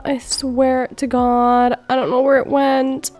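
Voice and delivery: ordinary delivery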